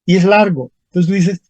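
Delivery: ordinary talk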